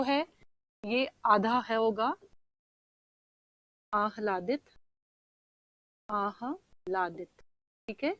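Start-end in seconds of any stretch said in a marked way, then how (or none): 3.9-7.4 आह्लादित spelled out slowly and softly, syllable by syllable; slow careful pronunciation. Shown as a demonstration. Teacher slowly models आह्लादित for students, soft careful pronunciation. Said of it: "ये शुद्ध शब्द है".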